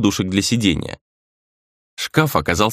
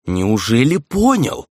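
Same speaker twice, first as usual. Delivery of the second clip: slow, drawn-out mock surprise; sarcastic; 3 semitones higher, slower than usual. A narrator's reading. Rei doubting Grizzly's understanding, sarcastic. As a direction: feign amazement with sarcasm. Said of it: "саркастически усмехнулся Рэй".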